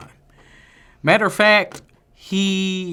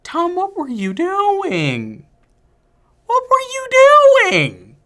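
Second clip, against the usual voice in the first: mock despair at the actor; exasperated plea; 4 dB louder than usual. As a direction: plead with him in disbelief, pitch climbing.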